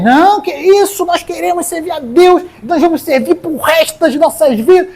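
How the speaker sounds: high, earnest voice of the people pledging; eager crowd answering. Acted. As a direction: answer like a crowd promising loyalty. People answering Josué again.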